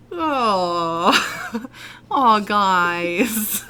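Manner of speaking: hammed-up sobbing through laughter; mock crying. Mimicking the sad voiceover lady, mock crying. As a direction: fake a tearful wail. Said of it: "she's just".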